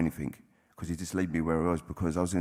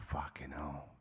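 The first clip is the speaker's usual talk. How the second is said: breathless whispered awe; whispered thought; 4 semitones lower, 14 dB quieter, slower than usual. Retold or imitated. Reading the sponsor's letter, a stunned whisper. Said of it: "And I thought".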